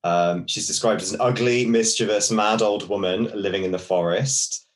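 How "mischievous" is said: normal talking voice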